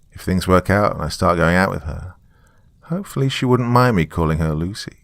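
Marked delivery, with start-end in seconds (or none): none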